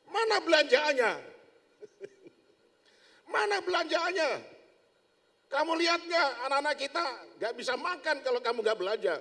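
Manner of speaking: high, loud scolding wife; angry nagging. Acted. Wife angrily nagging husband who came home without groceries. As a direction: rant at him for empty hands. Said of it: "langsung istrinya ngomel, marah besar".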